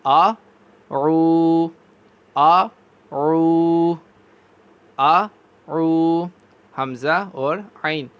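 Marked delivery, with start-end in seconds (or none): 0.0-6.2 آ عو sounded as two different throat letters; hamza then ain. Shown as a demonstration. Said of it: "میرے ساتھ پڑھیے ذرا".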